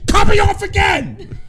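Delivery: high, loud outburst; mock furious shout. Host to crew threatening his mic, mock furious shout. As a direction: yell in hammed-up outrage. Mock rage at being cut off.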